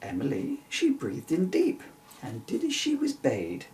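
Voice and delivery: ordinary delivery, nothing marked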